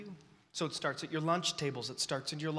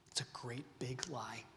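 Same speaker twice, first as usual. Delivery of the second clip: soft, lower confiding whisper; 3 semitones lower, 9 dB quieter than usual. Real, not acted. Preacher whispering the truth to the young crowd. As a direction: whisper it like a secret exposed.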